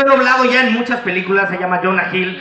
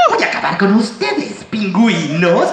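villain voice; 2 semitones lower than usual. Retold or imitated. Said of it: "doblar al Doctor Espiráculo".

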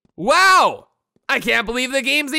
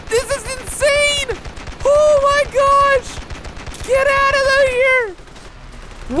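much higher, slow stretched cries; high excited squeal; 11 semitones higher, slower than usual. Real, not acted. Gamer squealing at six hundred kills, high excited yell. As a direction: squeal it in pure disbelief. Massive kill count climbing.

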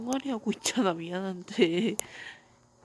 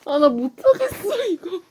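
laughing in defeat; 8 semitones higher, 5 dB louder than usual. Real, not acted. Laughs after dying.

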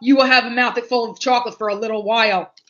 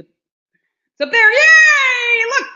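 much higher, louder excited cry; excited shout; 12 semitones higher, 7 dB louder, much slower than usual. Real, not acted. Grabbing the candy off the shelf, an excited high shout. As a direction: squeal with joy.

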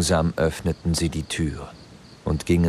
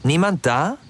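higher, louder call into an empty house; hello, anyone in there; calling out into the dark house; 10 semitones higher, 4 dB louder than usual. A narrator's reading. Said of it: "riefen sie einige Male".

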